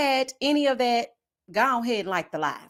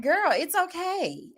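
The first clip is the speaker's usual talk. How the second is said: mock soothing; 7 semitones higher, slower than usual.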